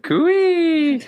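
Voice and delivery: high cooey call, drawn out; playful far-carrying holler. Pretend. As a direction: sing out a long high "cooey" to hail someone. Calling out the Australian greeting.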